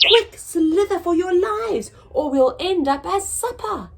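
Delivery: high, loud panicked warning; panicked cry. Acted. Snails warn each other, high panicked cry. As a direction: shriek it as the bird swoops. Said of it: "cried the snails in panic".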